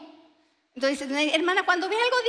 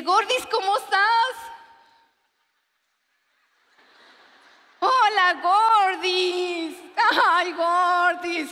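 gushing greeting; 4 semitones higher, slower than usual. Retold or imitated. Sister greets her as Gordis.

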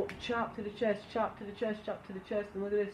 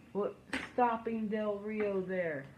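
ordinary talk

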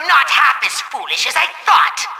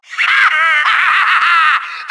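manic villain laughter; evil cackle. Acted. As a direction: cackle in gloating triumph. Villain gloating.

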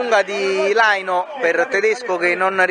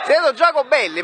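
excited goal call; 6 semitones higher than usual. Real, not acted. Goal call after scoring.

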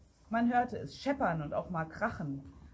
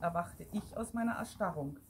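plain reading voice

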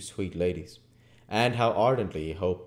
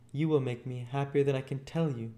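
high, gentle lady's voice; kindly young lady; 7 semitones higher than usual. Acted. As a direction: speak sincerely as a gracious lady.

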